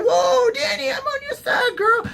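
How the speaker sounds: very high, squeaky mock protest; Varys. Varys pleading loyalty to Dany, squeaky mock alarm. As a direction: squeal it, hammed-up backing off. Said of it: "he's like".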